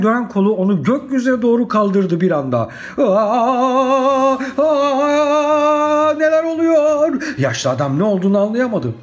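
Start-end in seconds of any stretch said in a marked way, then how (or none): 3.0-7.2 very high, drawn-out scream; hammed-up alarmed cry, held high; panicked wailing. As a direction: wail as you are swept into the air. Knight lifted skyward by windmill.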